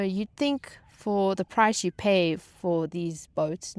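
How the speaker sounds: ordinary talk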